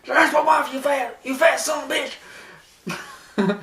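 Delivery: high, strained mock-furious shouting; Triple H. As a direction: yell insults as if in mid-beating. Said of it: "Triple H is like".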